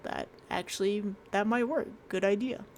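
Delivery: ordinary talk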